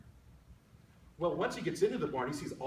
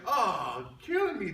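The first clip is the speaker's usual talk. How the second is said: exasperated worm; 4 semitones higher, 6 dB louder, much slower than usual. Acted.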